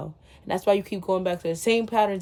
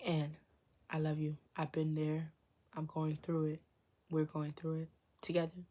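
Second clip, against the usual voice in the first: tender; 4 semitones lower, 9 dB quieter than usual. Real, not acted. Soft tender close.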